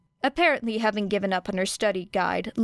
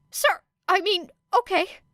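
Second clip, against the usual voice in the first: high nervous stumble; flustered stammer; 11 semitones higher than usual. A narrator's reading. Midoriya scolded by Shinso, flustered high stammer. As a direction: fumble the reply.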